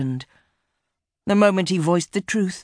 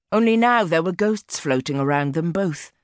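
plain reading voice

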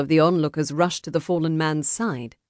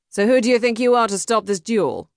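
higher, irritated demand; snarled challenge; 6 semitones higher, 4 dB louder than usual. A narrator's reading. Duelist to the man stopping the duel, snarling lightly. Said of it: "No Name snarled".

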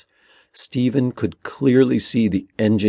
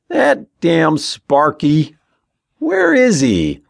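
slow, high, raised-voice holler; shouting over noise; 7 semitones higher, 5 dB louder, slower than usual. A narrator's reading. Ben to Tommy over the engines, shouting. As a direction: stretch it out to carry over engines. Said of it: "Ben had to shout above the rumbling".

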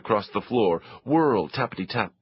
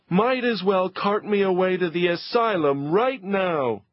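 despairing mother; 9 semitones higher than usual. Acted. Mother despairing at the chaos, high and dramatic. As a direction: throw up your hands at it all.

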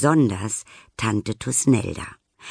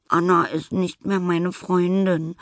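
sobbing; 8 semitones higher than usual. Acted. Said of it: "schluchzt Nelly".

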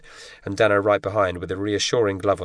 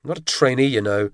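indignant; 5 semitones higher than usual. A narrator's reading. James answers back to the instructor, indignant. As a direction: push back at the bully. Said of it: "James said indignantly".

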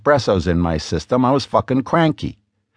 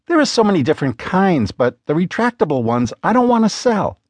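lightly voiced wife, higher and nagging; a hint of an exasperated wife pressing her husband; Lena's voice; 8 semitones higher than usual. A narrator's reading. Wife urging James to take interest, lightly raised exasperated voice.

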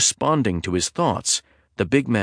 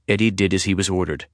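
ordinary delivery, nothing marked

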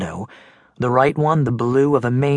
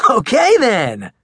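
put-on high, loud goofy boy voice; big teasing kid; 10 semitones higher, 5 dB louder than usual. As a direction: tease your friend loudly, laughing it off. Max voice.

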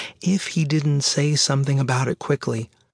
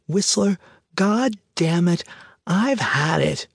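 angry woman bursting in, yelling; shouting; 4 semitones higher than usual. Acted. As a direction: shout it out furious and high. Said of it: "the woman in the doorway shouting".

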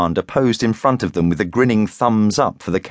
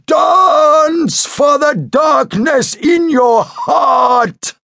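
huge high, slow drawn-out bellow; 6 dB louder, much slower than usual. A narrator's reading. Mystic summoning his circle of dancers to begin, bellowing. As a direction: stretch every word into a ritual roar. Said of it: "he held up his right arm and bellowed".

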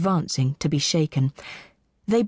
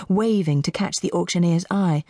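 ordinary delivery, nothing marked

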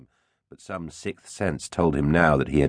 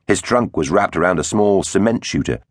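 usual speaking voice

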